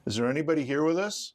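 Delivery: loud, high call into the dark. Real, not acted. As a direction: call out to any spirits present. Calling out to spirits.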